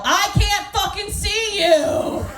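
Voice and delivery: high, slow frustrated mock yell. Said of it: "I just have to yell".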